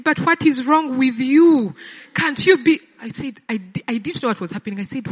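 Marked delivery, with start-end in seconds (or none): 0.0-2.8 sharp, high, loud and slow; mother yelling at her daughter early in the morning, loud and high; mother shouting. Retold or imitated. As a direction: imitate a mother scolding down the phone. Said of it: "after she had shouted at me".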